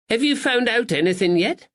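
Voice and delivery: high, bright-voiced kindly lady; sweet older lady greeting a visitor; Miss Bennett. Spinster greets the constable at the shop, pleasantly high.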